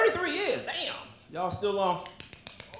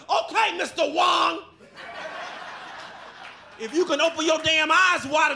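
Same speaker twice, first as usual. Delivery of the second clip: mock sarcastic driver; 5 semitones higher than usual.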